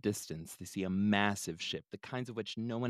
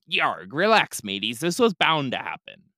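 pirate captain; 6 semitones higher, 13 dB louder than usual. Acted. Captain calms his crew.

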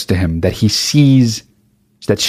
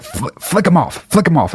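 urgent dad; 8 semitones higher than usual. Retold or imitated. Dad urging angrily in car.

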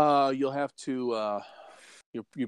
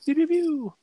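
mock gun sounds.

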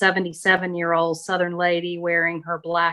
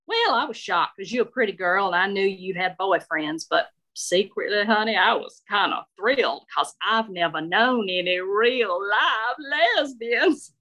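confiding Southern matron, rising to a thrilled finish; frank older Southern lady. Acted. Shirley admits she was secretly thrilled, frank Southern lady. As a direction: confess it with relish as an old Southern lady.

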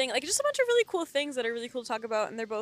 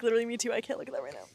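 usual speaking voice

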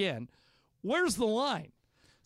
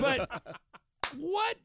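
laughing; 9 semitones higher than usual. Real, not acted. Laughing disbelief after old man bit.

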